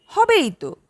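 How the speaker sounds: "হবেই তো" with both words suddenly pressed hard; sudden emphasis. Acted. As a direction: lean hard on "হবেই তো", a little too forcefully. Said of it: "শেষ শব্দ দুটোতে হঠাৎই জোর পড়ে গেল".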